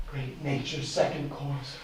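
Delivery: ordinary talk, nothing marked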